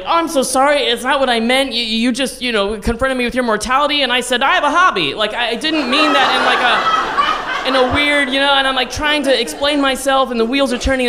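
high, flustered, tumbling apology; nervous wreck. Retold or imitated. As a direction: babble sorry in a high panicky voice. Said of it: "And then I become a nervous wreck".

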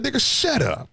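fed-up parent, not shouted; retold scolding. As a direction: act out cutting off a whining kid. Retold telling crying son off.

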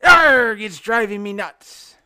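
very loud Arg, then a slow pirate punchline. Retold or imitated. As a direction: ham up a cartoon pirate delivering the joke. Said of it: "And the pirate goes".

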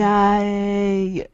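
drawn-out excited cheer. Real, not acted. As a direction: cheer it, holding the vowel long. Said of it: "I was being excited".

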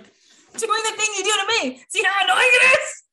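high, loud gotcha; triumphant shout. Retold or imitated. Wife showing husband his own habit, high and loud. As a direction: shout it back at him, laughing. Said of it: "I was like".

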